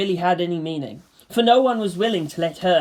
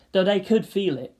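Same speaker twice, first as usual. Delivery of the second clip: usual speaking voice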